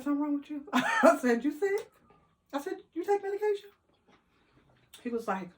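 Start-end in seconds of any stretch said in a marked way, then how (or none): 0.0-3.7 higher, sly sarcastic questions; high teasing are-you-sick; mock concern. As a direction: needle him with fake worry.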